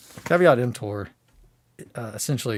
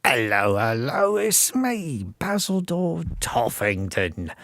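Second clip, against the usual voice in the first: Basildur Toffington; 3 semitones higher, slower than usual. Acted. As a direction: introduce yourself as a dainty little demon. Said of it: "That's how he talks".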